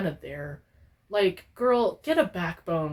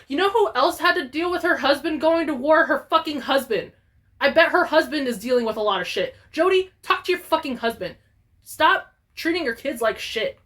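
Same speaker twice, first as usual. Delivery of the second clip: higher, fast, worked-up rant; heated rant; 6 semitones higher, 4 dB louder than usual. Real, not acted. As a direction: lay into her, getting fired up.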